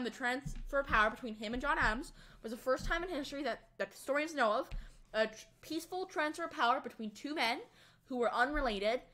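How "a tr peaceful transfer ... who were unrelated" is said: ordinary delivery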